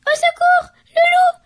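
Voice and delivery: high, loud panicked shriek; piglets screaming. Acted. Piglets spotting the wolf, high terrified scream. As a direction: shriek for help in terror. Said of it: "hurlèrent les cochonnets".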